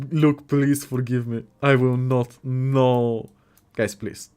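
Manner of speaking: ordinary talk, nothing marked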